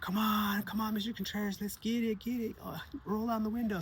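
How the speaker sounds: put-on high, eager kid begging through the window; boy knocking on the car window, begging the teacher to roll it down, high and eager; pleading kid voice. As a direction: raise the pitch and plead playfully.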